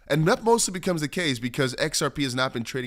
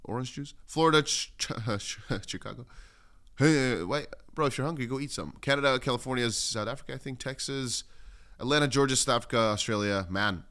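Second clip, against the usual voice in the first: ordinary delivery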